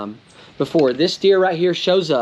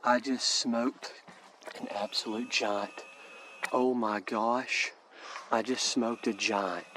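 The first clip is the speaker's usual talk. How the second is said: barely above a whisper, stunned and thrilled; awed whispering to himself over the kill; breathless hushed whisper; 2 semitones lower, 9 dB quieter than usual. Real, not acted. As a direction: keep it hushed so the woods stay quiet. Hushed after shooting a deer.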